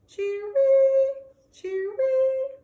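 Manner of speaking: high, loud cheer-wee call; sad bird call. Acted. The caged bird calling to the dog, high and loud. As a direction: chirp it out like a lonely bird. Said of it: "It sounds sad".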